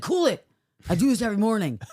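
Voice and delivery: high, loud mock husband brushing it off. Husband voiced brushing her off.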